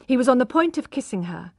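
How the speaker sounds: ordinary delivery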